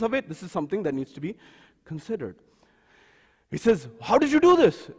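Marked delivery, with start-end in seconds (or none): 4.0-4.9 upset. Retold or imitated. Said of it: "Musa gets upset at Khidr".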